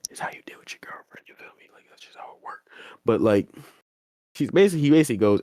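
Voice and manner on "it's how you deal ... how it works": barely voiced whisper; hushed aside. Real, not acted. Host slips listeners advice on a girlfriend, whispered aside. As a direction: drop right down to a confiding whisper.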